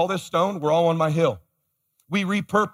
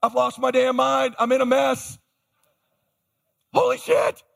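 mock panic; 7 semitones higher than usual. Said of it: "my heart starts racing".

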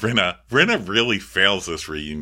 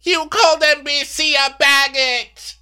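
very high, loud screech; hammed-up screechy finger-pointing; mock hysterical accusation; 5 dB louder than usual. Castmate accuses her over NBC, mock hysterical screech. Acting out Rinna's shrieked accusation.

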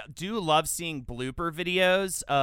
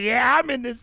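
raised, mock-enthusiastic [name] quote; 8 semitones higher, 8 dB louder than usual. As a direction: call it out as a cocky performer. Voicing [name] in the show.

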